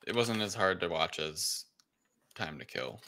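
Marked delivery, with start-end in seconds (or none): none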